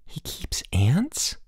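slow, hushed, rising question; disbelief. Acted. Boots asking his friend about the ants, hushed disbelief. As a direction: breathe it out, unable to believe it. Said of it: "Boots asked in disbelief".

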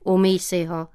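usual speaking voice

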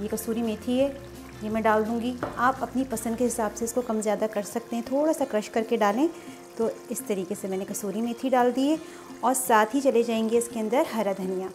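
ordinary talk

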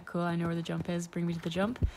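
ordinary talk

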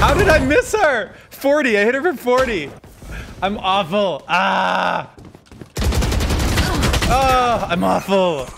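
high, loud exasperated outburst; frustrated yelling. Real, not acted. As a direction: wail at your own bad aim. Frustrated at missing shots in game.

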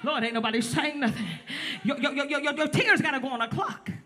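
loud, rhythmic sermon, pushing hard to the crowd; hammering the congregation with call and response; fiery preaching. Acted. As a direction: preach it at full force to the room. Preaching to congregation, full force.

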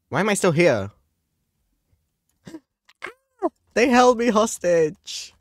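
high loud disbelief; shocked squeal. Real, not acted. Shocked still in game.

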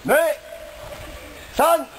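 shouted dojo commands; shouted drill count. Real, not acted. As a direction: call the count like a sensei. Count for the kiai.

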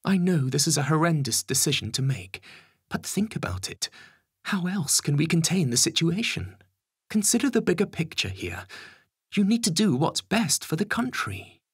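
high, pressing official voice; Home Secretary. Acted. Home Secretary urging the Prime Minister to decide, high and insistent.

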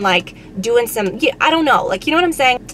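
usual speaking voice

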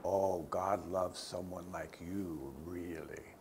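low, soft, slow sneer fading to a whisper; low mocking whisper of the accuser; sarcastic tempter voice. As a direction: drip doubt like a tempter. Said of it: "a different king's voice".

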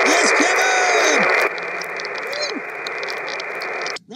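loud, slow, drawn-out triumphant yell; excited shout. Real, not acted. Cheering his radio buddy Kevin, loud excited shout. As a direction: yell it out like you just won.

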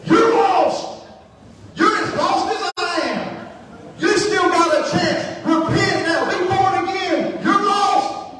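high-pitched, full-force preaching yell; slow, stretched-out shouts of urgent warning; desperate shouting. Acted. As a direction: cry out at the top of your voice to convert them. Acting out his outburst at the therapist.

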